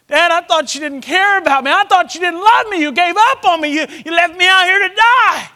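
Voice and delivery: high, loud, pleading cry to his father; boy's desperate plea. Retold or imitated. Lost boy crying out to his dad who found him, loud and high. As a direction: let it pour out like a hurt child.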